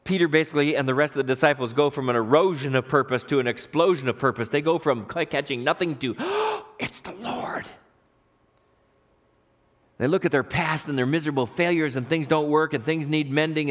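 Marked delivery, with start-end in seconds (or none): 6.1-7.7 sharp gasp, then awed whisper; gasp, then whisper the discovery. Acted. Disciples go from catching nothing to recognition, awed gasping whisper.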